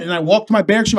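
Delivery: usual speaking voice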